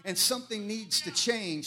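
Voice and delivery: ordinary delivery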